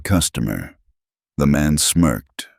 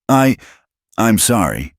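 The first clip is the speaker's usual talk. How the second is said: stammering; 6 semitones higher than usual. A narrator's reading. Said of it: "Maria stammered".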